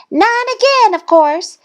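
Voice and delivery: high, loud excited scream. A narrator's reading. Thistle beating Goose again, high scream. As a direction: scream it out like a show-off duckling. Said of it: "Thistle screamed".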